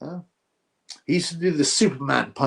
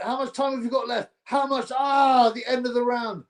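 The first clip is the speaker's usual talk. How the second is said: excited shouting; 10 semitones higher than usual. Real, not acted. Fan watching a live fight, screaming excitedly as Bostan gets hurt. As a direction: yell as your fighter nearly drops him.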